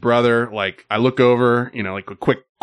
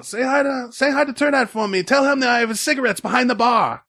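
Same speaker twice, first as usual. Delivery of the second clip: much higher friendly imitation; Tien's voice; 12 semitones higher than usual. Bartender sends greetings to a regular, high imitated voice. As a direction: keep the pitch high as Tien. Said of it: "that's what he sounds like".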